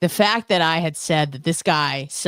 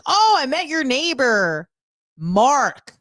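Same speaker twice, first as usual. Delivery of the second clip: a hint of a chummy dad, pitched high; cheerful dad; 9 semitones higher, slower than usual. Dad coming back from meeting the neighbor, chummy. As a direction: imitate the buddy-buddy father. Dad voiced in retold story.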